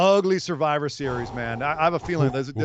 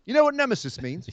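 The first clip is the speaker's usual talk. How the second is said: higher, put-on Cockney question; Cockney accent; 5 semitones higher than usual. Quizzing co-host in a mock Cockney accent. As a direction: pose it as a Cockney villain. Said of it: "Cockney accent".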